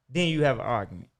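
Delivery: normal talking voice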